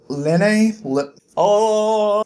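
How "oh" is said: ordinary talk, nothing marked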